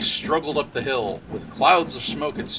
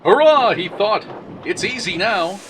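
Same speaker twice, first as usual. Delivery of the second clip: cheering; 9 semitones higher, 9 dB louder than usual. Acted. James cheers at hilltop.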